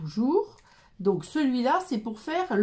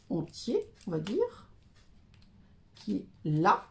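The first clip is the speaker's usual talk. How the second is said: ordinary talk, nothing marked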